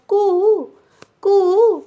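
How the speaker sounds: high, loud cuckoo coo; cuckoo call. Shown as a demonstration. Teacher showing children the koel's call, high and sung. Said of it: "कैसे सुनाई देती है उसकी आवाज़".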